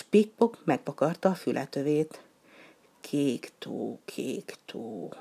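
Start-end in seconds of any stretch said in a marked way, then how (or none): 3.0-5.1 lower, much softer musing; musing mutter. Acted. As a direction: turn it over in your head quietly. Penguin muses to himself.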